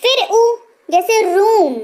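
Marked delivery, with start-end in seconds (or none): 1.2-1.7 "रूम" with a long, pressed oo; stressed long oo. Shown as a demonstration. Teacher pointing out the force in "रूम", long oo. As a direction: lean on the oo in "रूम". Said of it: "यहाँ पे देखिए जोर डल रहा है".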